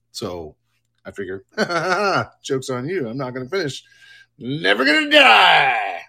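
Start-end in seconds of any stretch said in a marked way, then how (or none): 4.4-6.0 loud, slow, drawn-out shout; mock triumphant yell. Streamer jokes he'll never finish the series, mock triumphant shout. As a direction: bellow it like a defiant victory cry.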